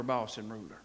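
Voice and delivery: quiet aside. Shown as a demonstration. Preacher admitting men struggle with this, low and soft. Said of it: "hard for us to say".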